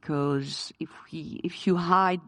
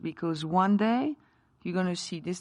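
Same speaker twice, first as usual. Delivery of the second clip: ordinary talk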